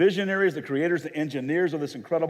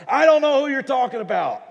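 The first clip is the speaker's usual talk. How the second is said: furious high shout; angry cursing denial; 13 semitones higher, 11 dB louder than usual. Acted. Peter cornered by accusers, shouting angry denial. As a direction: yell the denial at full force. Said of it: "He starts to curse and cuss and says".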